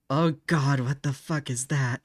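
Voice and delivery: normal talking voice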